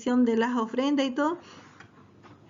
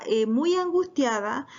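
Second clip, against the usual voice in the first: ordinary talk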